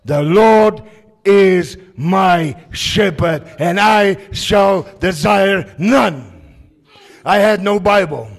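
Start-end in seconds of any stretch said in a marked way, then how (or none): 0.1-6.3 "The Lord is my shepherd" proclaimed higher and louder, slow; slow proclamation. Acted. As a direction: declaim each word slowly, full voice. Preacher proclaims the psalm.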